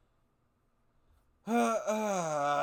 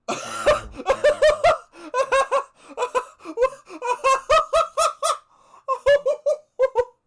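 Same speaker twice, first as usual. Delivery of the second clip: loud high shrieking laugh; burst of laughter; 9 dB louder than usual. Real, not acted. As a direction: explode into laughter, caught off guard. Caught off guard by text.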